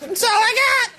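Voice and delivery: high-pitched mock yell to a crowd; hammed-up high cry; mock desperate plea. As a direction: squeal it out, begging for applause. Mock plea pimping for applause.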